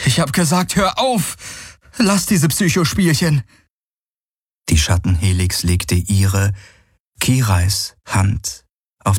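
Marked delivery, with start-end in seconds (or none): 0.1-3.4 high, sharp cry to stop; desperate furious protest; angry outburst. Acted. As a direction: lash out at the phantom. Galen shouting at helix.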